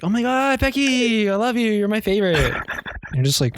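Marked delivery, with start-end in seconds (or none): 0.0-2.4 very high, mock squealing affection; gushing theater kid. Theater kid making up with Becky, hammed-up gushing. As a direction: overdo a drama kid's sudden adoration. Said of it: "two weeks later, they're like".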